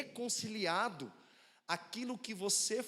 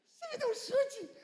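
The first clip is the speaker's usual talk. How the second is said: much higher, louder, outraged disbelief; as if you can't believe he just kicked you; shocked disbelief; 5 dB louder than usual. Retold or imitated. Father stunned that his five-year-old kicked him, shocked outrage.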